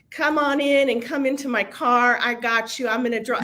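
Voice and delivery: put-on a high, half-hearted Southern accent; attempted Southern drawl. Southern agent soothing the late speaker, mock Southern drawl. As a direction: try a sweet Southern lady, not quite landing it. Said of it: "I can't do the Southern drawl".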